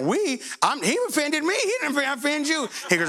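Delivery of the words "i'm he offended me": normal talking voice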